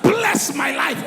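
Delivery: hammed-up congregational praying; fervent mock prayer. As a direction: cry out to the Father, drawing each word out. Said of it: "You are going to pray".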